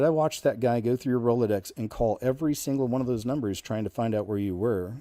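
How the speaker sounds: ordinary delivery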